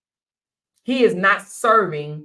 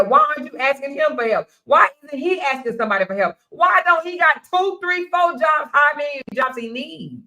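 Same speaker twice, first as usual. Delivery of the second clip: indignant rant; 8 semitones higher than usual. Real, not acted. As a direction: build into outraged questions. Rant at broke boyfriends.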